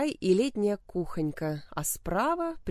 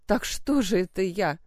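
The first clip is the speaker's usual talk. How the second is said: indignant; 2 semitones higher, 4 dB louder than usual. A narrator's reading. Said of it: "возмущённо проговорила она".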